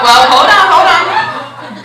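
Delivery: sudden loud stop. Real, not acted. Interrupts herself mid-story.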